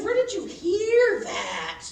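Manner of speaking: alarmed question. Retold or imitated. Questioning her dad.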